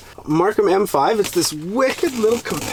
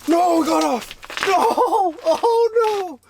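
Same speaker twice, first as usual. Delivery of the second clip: dismayed cry; 11 semitones higher, much slower than usual. Real, not acted. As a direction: yelp as the fish escapes. Fish gets off the hook.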